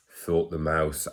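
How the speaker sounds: ordinary delivery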